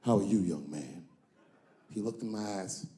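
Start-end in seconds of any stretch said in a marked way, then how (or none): 0.0-1.0 much lower, smooth O.J. voice; imitating O.J. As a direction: greet him like a smooth celebrity. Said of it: "shook my hand".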